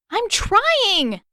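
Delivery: high, loud strained yell; strained shout. A narrator's reading. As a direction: shout back while pulling hard. Katara straining to pull.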